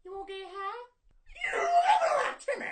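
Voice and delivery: very high squeaky cartoon; shrill cartoon squeal; Mr. Hankey voice. Cartoon impressions.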